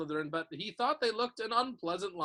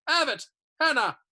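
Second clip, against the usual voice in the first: calling out names; 9 semitones higher, 9 dB louder than usual. A narrator's reading.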